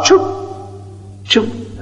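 whispered scoop noise; quiet unvoiced choop; soft slurp sound. Shown as a demonstration. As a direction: imitate the ghee sliding off the spoon. Scooping offering into fire.

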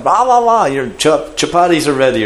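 high-pitched imitated calling out; girl yelling. Girl calling father in from the field, high imitated yell. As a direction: imitate a girl hollering to someone far away. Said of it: "she's yelling".

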